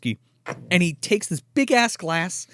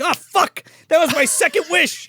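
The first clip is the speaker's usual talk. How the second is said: much higher, aghast realization; dismayed Darby; 12 semitones higher, 3 dB louder than usual. Retold or imitated. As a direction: realize the blunder in horror. Darby realizing wasted wish.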